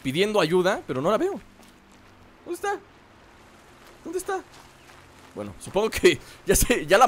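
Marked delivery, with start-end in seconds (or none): 2.4-2.8 hushed searching. Real, not acted. Searching for the screaming woman. 4.0-4.4 hushed searching. Real, not acted. Searching for the screaming woman.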